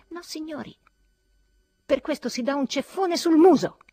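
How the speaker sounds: high-pitched outburst building to loud; indignant shriek. A narrator's reading. Ferfitkin demanding a slap for the insult, shrieking. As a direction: protest shrilly, ending at full volume. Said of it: "strillò Ferfitkin".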